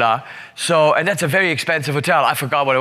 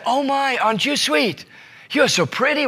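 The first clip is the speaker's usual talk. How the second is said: high-pitched cooing to a child, imitated; gushing sweetness; 7 semitones higher than usual. Hotel agent kneeling to greet a little girl, gushing. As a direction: coo sweetly, higher than your own voice. Retold woman cooing at small daughter.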